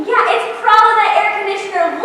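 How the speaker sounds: ordinary talk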